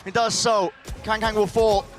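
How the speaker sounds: excited play-by-play call; esports caster. Real, not acted. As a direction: call the action live.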